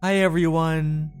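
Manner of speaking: plain reading voice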